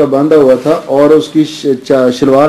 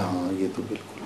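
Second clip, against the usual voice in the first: much softer, meek yes; a young man meekly accepting advice; meek soft agreement; 7 semitones lower, 19 dB quieter than usual. Retold or imitated. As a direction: agree quietly, a little abashed.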